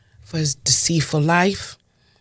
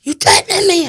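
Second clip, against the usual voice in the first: even higher, louder imitation, ending on a falling snap; mock indignant killer, repeated; 7 dB louder than usual. Killer repeating his challenge at the window, higher and louder in mock outrage. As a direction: press it the second time, daring her. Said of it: "He says to me".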